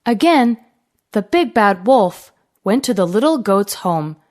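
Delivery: ordinary delivery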